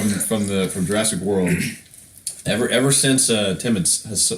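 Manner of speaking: usual speaking voice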